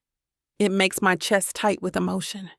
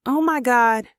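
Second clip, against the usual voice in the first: excited; 5 semitones higher, 5 dB louder than usual. Acted. Burley excited about photo album.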